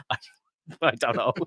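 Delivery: words breaking into laughter; laughing helplessly. Retold or imitated. Answering the puzzled parent, laughing and helpless. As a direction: answer through a giggle. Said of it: "and I was like".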